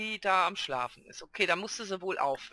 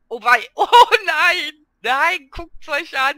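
loud laughing dismay, slowed, louder; cracking up while pointing at the chaos; 7 semitones higher, 13 dB louder, slower than usual. Real, not acted. Laughing loudly as she shows viewers the mess.

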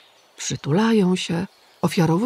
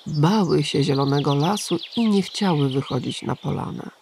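ordinary delivery

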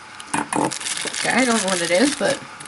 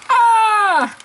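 high, loud mock yelp; imitated bear's sudden cry; panicked bear yelp; 9 semitones higher, 4 dB louder than usual. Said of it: "He's like".

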